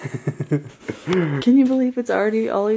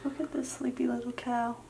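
soft tender whisper; whispering over a crib; 4 semitones higher, 11 dB quieter than usual. Real, not acted. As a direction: whisper so she stays asleep. Dog asleep.

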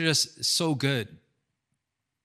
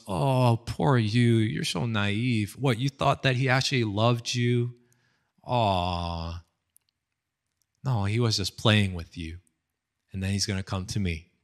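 slightly lowered, condescending drama-villainess taunt; mock pity; 4 semitones lower than usual. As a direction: act out a rival gloating with fake sympathy. Envious rival pities main girl.

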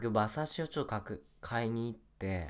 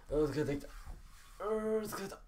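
drained, softer sigh of tiredness; tired; 8 semitones higher, 6 dB quieter than usual. Real, not acted. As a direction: let fatigue into the voice. (疲れた声で)

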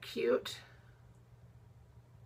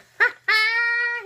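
loud, high-pitched squealing laugh; delighted laugh; 15 dB louder than usual. Real, not acted. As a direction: burst out in a high cackle of delight. Laughs at the poop card.

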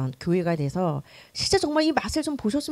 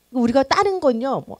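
ordinary delivery

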